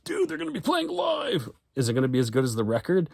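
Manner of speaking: hammed-up excited buddy; dude friend voice. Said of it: "Why would I wanna go".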